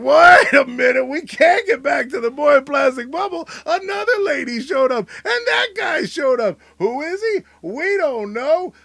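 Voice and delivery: high, loud outburst; excited shout. Real, not acted. Host breaks off his own topic, yelling excitedly. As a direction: cut yourself off, thrilled.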